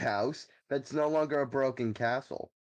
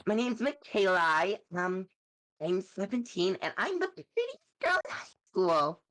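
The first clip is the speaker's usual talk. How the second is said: bimbo voice; 4 semitones higher than usual. Pretend. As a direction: play the ditzy popular girl. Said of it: "love doing my bimbo voice".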